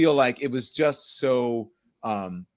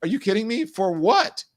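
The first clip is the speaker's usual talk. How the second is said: incredulous retort; 10 semitones higher than usual. Retold or imitated. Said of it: "I was like".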